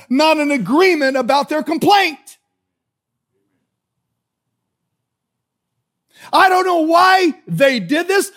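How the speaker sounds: loud, high-pitched pulpit delivery; impassioned preaching. Real, not acted. Preacher warning the congregation about complainers, impassioned and loud. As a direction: preach it out at full force, hammering each phrase.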